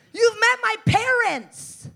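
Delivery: high, loud, drawn-out disbelief; exasperated shout. Acted. To best friend who asked if Cherokee, exasperated shout. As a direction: yell it slowly, incredulous at a friend.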